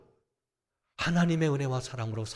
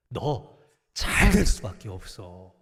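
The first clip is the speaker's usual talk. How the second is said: slow, louder reassurance stretching 될; slow firm blessing; 7 dB louder, slower than usual. Retold or imitated. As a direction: press the promise home, slowly. Parent's closing blessing, slowed. (천천히 힘주어 확신을 주는 부모)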